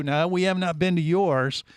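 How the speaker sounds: ordinary delivery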